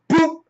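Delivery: short high-pitched "boop"; little high pop of the voice; boop sound effect. Acted. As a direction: imitate a comic hit. Imitated blow after "Thanos goes.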